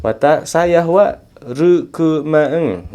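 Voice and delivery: "rukumaeng" slow, high and loud with a long ng; emphatic, stretched "rukumaeng", R for L; Japanese pronunciation demo. Shown as a demonstration. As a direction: sound out "rukumaeng" syllable by syllable. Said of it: "dibacanya eng".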